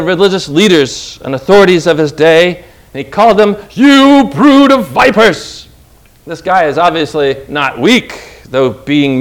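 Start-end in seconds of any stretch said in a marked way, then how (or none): 3.7-5.7 stern rebuke. Retold or imitated. Jesus rebuking religious leaders.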